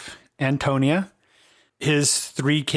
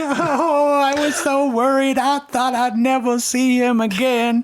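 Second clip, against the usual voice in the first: put-on high, louder tearful old woman, slowing down; crying grandma; 11 semitones higher, 4 dB louder, slower than usual. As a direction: cry with relief at the boy.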